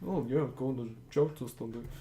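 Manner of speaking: mumbled small talk, barely voiced; under their breath. Game master plays guards chatting idly, under their breath. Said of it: "like, under their breath".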